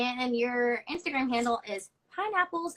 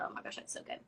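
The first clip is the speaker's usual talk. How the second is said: breathless whispered aside; hushed, touched whisper; 14 dB quieter, faster than usual. Real, not acted. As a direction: whisper it in awe. Moved reaction while reading a note.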